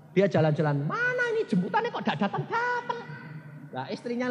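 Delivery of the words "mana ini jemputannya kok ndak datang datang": high, laughing, impatient complaint; impatient uncle. Acted. Uncle waiting for his heavenly pickup, high and impatient. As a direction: grumble high about the late ride.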